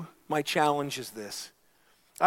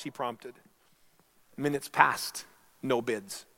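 storytelling voice in a sermon; story narration; 2 semitones higher, 5 dB louder than usual. Acted. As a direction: tell the parable to the congregation.

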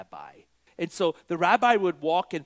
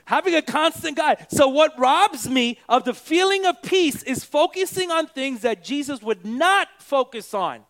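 loud, raised-pitch sermon delivery; emphatic, driving preacher; full-force preaching; 9 semitones higher, 8 dB louder than usual. Real, not acted. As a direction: preach it out with punched key words.

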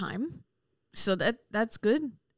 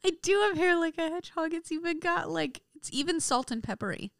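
laughing; 9 semitones higher than usual. Real, not acted. Laughing agreement about her hair.

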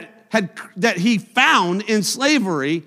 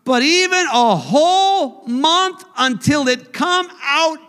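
loud, higher, slowed climax; preaching at full force; 8 semitones higher, 4 dB louder than usual. Acted. Preacher driving home God's punishment, loud preaching. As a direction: thunder it out, stretching each phrase.

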